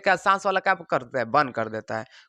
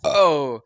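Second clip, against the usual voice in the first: a loud demonstrated burp sound; mock burp; 2 semitones lower, 5 dB louder than usual. Shown as a demonstration. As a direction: belch it out after a meal. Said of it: "खाना खाने के बाद".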